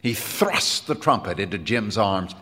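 ordinary delivery, nothing marked

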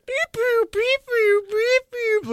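high beep boop noises; robotic beeping. Retold or imitated. Imitating confused driver.

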